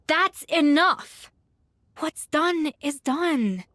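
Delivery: very slow, deliberate and firm; slow firm stop. Acted. Head chef cuts off the quarrel, slow and firm. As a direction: draw each word out to end the squabble. Escoffier halts the quarrel firmly.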